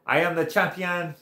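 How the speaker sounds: put-on high, proud French-accented declaration; hammed-up French accent, boasting; George Saint Pierre. French rider bragging to the bikers, imitated French accent. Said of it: "he sounded like George Saint Pierre".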